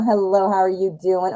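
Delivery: usual speaking voice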